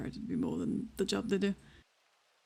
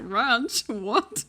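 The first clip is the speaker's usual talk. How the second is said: louder, incredulous echo, breaking into laughter; retold disbelief; 3 semitones higher, 7 dB louder than usual. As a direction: replay your baffled reaction, voice jumping up. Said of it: "And I was like".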